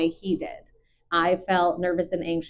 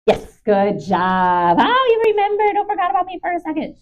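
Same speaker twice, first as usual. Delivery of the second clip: loud, much higher, happy praise; excited dog praise; 11 semitones higher, 7 dB louder than usual. Real, not acted. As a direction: burst out with delighted praise. Praising dog after a hard moment.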